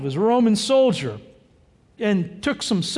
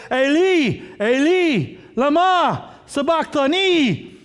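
higher, drawn-out cry; crying out loud; 4 semitones higher, slower than usual. Acted. Preacher voicing Jesus crying out from the cross, loud and high. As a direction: call each word out slowly and strongly. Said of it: "I'm saying it loud because he said it loud".

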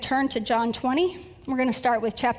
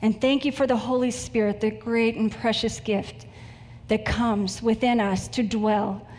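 earnest, measured prayer voice; 2 semitones lower than usual. Acted. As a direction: congregation prayer before the sermon, reverent and unhurried. Opens sermon with prayer.

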